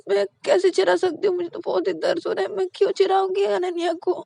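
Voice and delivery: whiny fake pain. Payal pretending pain to mom, high pitiful whine. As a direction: play innocent, milk the hurt.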